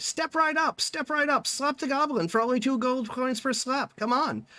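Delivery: high-pitched market barker's call; stall barker. Acted. Goblin stall owner drums up customers, barker's call. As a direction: call out a sales pitch to passers-by.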